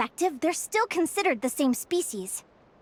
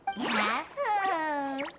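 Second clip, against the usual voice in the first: soft laugh; 2 semitones lower, 5 dB quieter than usual. Acted. After defiant speech on fate.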